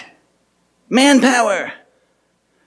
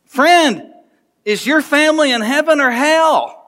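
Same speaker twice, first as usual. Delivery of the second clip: ordinary talk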